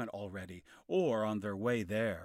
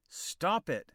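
sharp order; 6 semitones higher, 5 dB louder than usual. Acted. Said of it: "said Kay".

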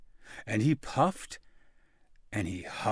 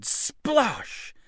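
high splash sound; 10 semitones higher than usual. A narrator's reading. Sound word in story.

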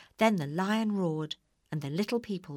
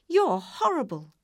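indignant; 7 semitones higher, 7 dB louder than usual. A narrator's reading. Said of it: "said Dorothy".

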